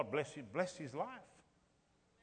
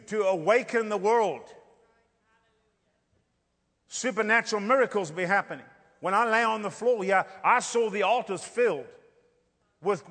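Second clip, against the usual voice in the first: raised, loud revival preaching, slowing on key phrases; fiery preaching; 5 semitones higher, 7 dB louder than usual. Acted. Preacher telling the church of coming miracles, at full force. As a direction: drive the vision home to the congregation.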